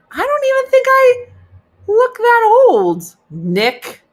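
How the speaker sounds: high, loud mock-outraged squeal; indignant outburst. Woman protesting to Nick about her age, indignant high shriek. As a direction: protest loudly and high, drawing out the words.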